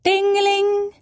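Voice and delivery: high sung ding-a-ling; mock telephone ring; imitated phone ring. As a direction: ring it out like a bright old phone bell. Phone ringing sound imitated.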